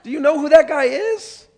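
high, loud, shouted disbelief with a laugh; outraged crowd. Retold or imitated. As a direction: play the grumbling crowd, half laughing in shock. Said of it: "they're like".

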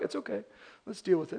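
soft, unflustered reassurance; hushed, matter-of-fact composure; calm doctor. Retold or imitated. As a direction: quietly take charge amid panic. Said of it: "but he's calm".

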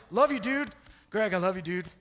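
a hint of a gruff buddy; bro voice. Buddy clapping a friend, lightly played bro voice. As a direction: toss it off like a jock. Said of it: "do a chest bump".

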